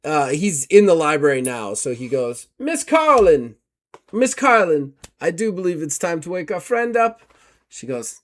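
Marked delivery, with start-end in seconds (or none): 2.6-7.2 a prim, courteous investigator, raised pitch; formal gentleman. Acted. GM as Gustav taking his leave of Miss Carlton, formal and polite.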